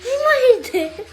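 high, laughing surprise; surprised laugh. Real, not acted. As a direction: echo it back amused.

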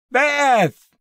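very high, loud scream for help; terrified scream. A narrator's reading. Husband screaming for his wife under attack, terrified scream. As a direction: scream the name in terror. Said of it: "he screamed".

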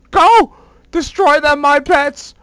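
unleash the army like an evil overlord; villain command. Pretend.

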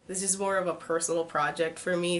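normal talking voice

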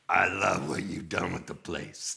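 lightly voiced impression of a laid-back passenger; slightly put-on voice, an understated dry quip. Musician getting into a fan's messy van, lightly imitated deadpan. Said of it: "he gets in the van".

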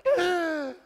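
high, loud mock gasp; startled gasp. Imitating old lady startled by the shout, gasping. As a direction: gasp as if scared half to death.

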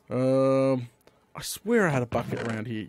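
ordinary delivery

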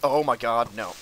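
loud, frantic bad idea chant; panicked shouting. Real, not acted. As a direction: yell it over and over in panic. Panicked repeated warning.

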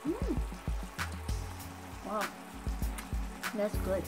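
ordinary talk

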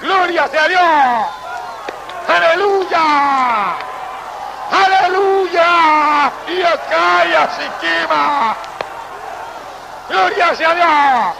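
high, slow preaching with stretched praise shouts; a preacher urging the church to praise like heaven, fervent shouting; full-force preaching. Acted. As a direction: call them to praise, stretching every aleluya.

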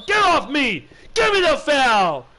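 loud slow shout at the game; raging gamer. Real, not acted. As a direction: yell it out, furious.

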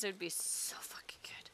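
hushed, whispered relish; longing whisper. Real, not acted. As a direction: whisper it like a fervent wish. Dreaming aloud of a remake.

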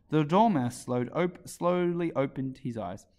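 ordinary delivery, nothing marked

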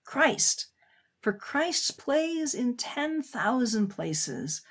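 slow, lifted poetry reading; poem recitation. Acted. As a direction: savour each line of the poem. Reads the poem aloud.

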